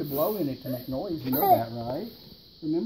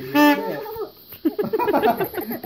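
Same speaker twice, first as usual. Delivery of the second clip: laughing; 13 semitones higher, 7 dB louder, much slower than usual. Real, not acted. Mother laughing while coaching child.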